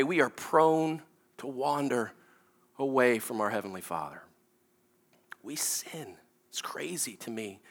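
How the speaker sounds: low, soft, grave reflection; hushed preaching. Real, not acted. Preacher confessing to congregation that we wander, hushed. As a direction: drop toward a near-whisper, with pauses.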